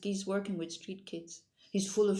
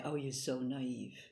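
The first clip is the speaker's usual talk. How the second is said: mocking naive jab; 4 semitones lower, 5 dB quieter than usual. Critics scoffing at her kindness, condescending. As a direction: sneer it like a doubter dismissing kindness. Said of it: "when people say".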